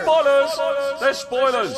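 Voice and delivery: high echoed warning; mock spoiler cry. Host echoing the spoiler warning, high and playful.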